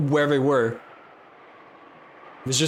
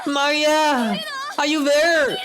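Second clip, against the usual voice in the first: frantic calling; 12 semitones higher than usual. Acted. Mother searching the windy garden for her daughter, frantic high calls. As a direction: call out desperately across the garden.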